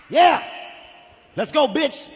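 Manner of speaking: hyped-up cheer. Retold or imitated. Rider locked into a roller coaster, excited. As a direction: pump yourself up for the ride.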